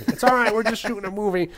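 high-pitched, loud mock reassurance; mock reassuring call. Film crew calming Beverly Hills neighbours over gunfire, mock reassuring call.

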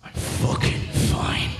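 slow, harsh voiceless strain; unhinged insistence. Acted. Sleepless man insisting to girlfriend, strained and unhinged. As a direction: force it out slowly without voice.

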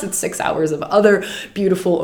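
normal talking voice

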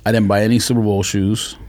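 normal talking voice

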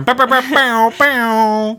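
high, loud siren imitation; mock alarm siren. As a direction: wail like a warning alarm. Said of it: "Sound the alarm".

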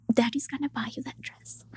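whispered fatherly promise; soft whispered daddy voice; doting father. Retold or imitated. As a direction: hush it like a proud dad. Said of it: "And he said".

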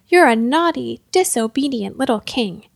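usual speaking voice

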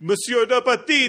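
high, cheery mock French call; French accent. Podcaster playing the officer switching back, bright French accent. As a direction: sing out a greeting with a French accent. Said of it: "he switches back to French".

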